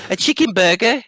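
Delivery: high, incredulous British lilt; mock English accent. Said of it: "they call it a".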